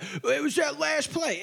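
high, slow mocking whine. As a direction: mimic the complainers in a whiny voice. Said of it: "everyone's making a big deal about".

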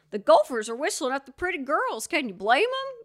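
mock tour narrator cracking a corny joke; hammed-up ride narrator. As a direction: play the cheesy old ride narrator delivering a gag. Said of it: "the narrator says stuff like".

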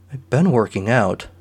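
ordinary delivery, nothing marked

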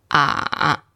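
Ah held very low. Shown as a demonstration. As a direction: keep "Ah" at the bottom of your voice. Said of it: "It's very low in your voice".

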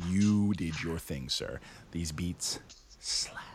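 whispered, very soft approval; hushed praise. Real, not acted. Reviewer praising the producer's beats, hushed whisper. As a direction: drop to a whisper for the verdict.

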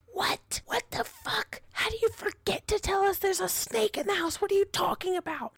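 high-pitched retold panic; hushed-then-high mock freak-out. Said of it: "freaking out".

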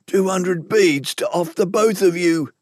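high, slow, uneasy confession; embarrassed and scared. Acted. Prisoner confessing the bounty to his targets, embarrassed and scared. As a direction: admit it reluctantly, high and scared. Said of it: "said in a hushed voice, embarrassed, scared".

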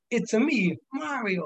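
high, cartoonish Mario voice; Mario impression. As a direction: squeak it out like Mario. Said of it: "and then I said".

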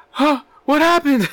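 high, slow, dazed stoner voice; a friend too high to follow the movie; stoned friend. Retold or imitated. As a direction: act baffled and baked. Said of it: "he would be like".

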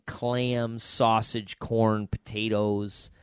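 usual speaking voice

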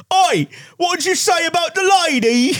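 high, shouted Cockney "oi"; Cockney gangster shout. Retold or imitated. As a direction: bark it like a London street tough. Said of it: "Cockney, like, gangster type guy".

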